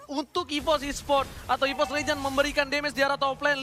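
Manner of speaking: normal talking voice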